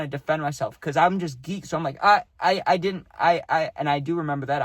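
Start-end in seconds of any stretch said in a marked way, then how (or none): 2.0-3.7 retold flustered stammer, higher and louder. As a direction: trip over the words as if defending yourself. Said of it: "I was, like, stumbling over my words".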